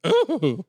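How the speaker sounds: high-pitched "ooh" of someone hit; imagined victim's noise. Retold or imitated. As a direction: imitate a squirted man's high yelp. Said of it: "the noise he made".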